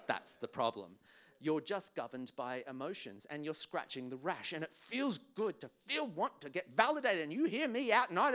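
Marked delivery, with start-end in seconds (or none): none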